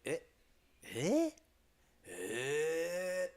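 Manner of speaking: high repeated mock eh; mock surprise. Streamer showing that eh works for anything. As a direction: gasp eh over and over. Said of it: "You'd be like".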